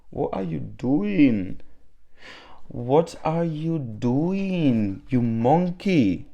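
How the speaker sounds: high, drawn-out singsong chiding; playful pet-talk. Pretend. Owner to Sora hiding behind the curtains, playful pet-talk. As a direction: coo a mock scolding, swooping slowly up and down.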